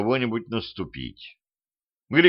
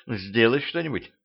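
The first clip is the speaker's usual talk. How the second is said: softer, begging plea; soft pleading; 5 dB quieter than usual. Acted. Вампирша тихо умоляет Петровича спасти её. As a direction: quietly beg him to save her. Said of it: "умоляюще посмотрела".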